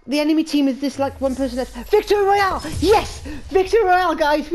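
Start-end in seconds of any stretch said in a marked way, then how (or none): 1.9-4.5 high, loud victory cheer; excited cheer. Real, not acted. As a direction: celebrate the win at full voice. Winning the match.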